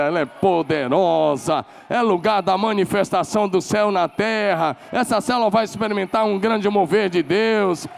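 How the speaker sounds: building from calm to loud, high proclamation; faith-filled declaration. Shown as a demonstration. Pastor showing the cell leader what to say, building proclamation. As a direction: declare it over your cell group, rising. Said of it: "você fala assim".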